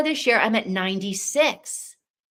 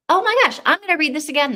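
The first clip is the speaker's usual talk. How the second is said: much higher and louder, gushing; delighted excitement; 8 semitones higher, 6 dB louder, faster than usual. Real, not acted. Telling the book club she'll reread it, thrilled and much higher. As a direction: squeal it like you found a treasure.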